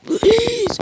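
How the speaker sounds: very high, squeaky begging; terrified plea. Acted. Masked robber begging Deku to spare him, terrified squeak. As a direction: beg for your life.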